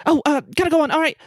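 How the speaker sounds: high, hesitant character voice; flustered singer. Acted. Game master voices B turning back.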